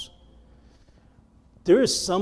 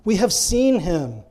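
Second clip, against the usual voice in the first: slow, drawn-out pleading; earnest, stretched-out appeal; trying hard to persuade; 4 semitones higher, slower than usual. Acted. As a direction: implore a friend to believe, starting high. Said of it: "They were trying so hard to persuade him".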